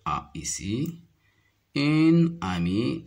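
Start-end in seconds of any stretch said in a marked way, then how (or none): 1.7-3.0 slow "Une amie" with "Une" lifted and loud; stressing une. Shown as a demonstration. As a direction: pick out "Une" clearly, slowly. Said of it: "la lettre A au début du mot".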